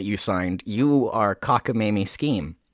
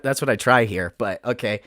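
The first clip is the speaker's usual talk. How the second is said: normal talking voice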